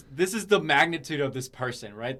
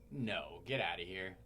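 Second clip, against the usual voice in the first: low, soft imitation of stunned denial; retold disbelief; 7 semitones lower, 9 dB quieter than usual. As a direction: reenact refusing the news, quietly and low. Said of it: "I didn't even believe it".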